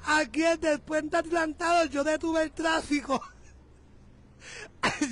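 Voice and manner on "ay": breathy laugh. Real, not acted. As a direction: laugh under your breath. Laughing at own stunt.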